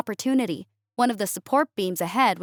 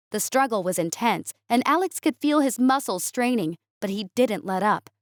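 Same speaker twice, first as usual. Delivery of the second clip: ordinary delivery, nothing marked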